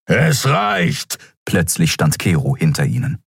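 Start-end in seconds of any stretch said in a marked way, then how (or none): 0.1-1.2 fed up. A narrator's reading. Kero cuts the lovers off.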